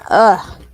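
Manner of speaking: sharp loud sneeze. Real, not acted. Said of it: "Hate them sneezes".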